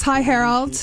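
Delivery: ordinary talk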